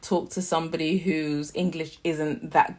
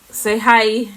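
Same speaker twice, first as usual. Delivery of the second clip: higher, louder parental prompt; 5 semitones higher, 8 dB louder than usual. Real, not acted. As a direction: encourage a little one to speak up. Prompting small daughter to greet viewers.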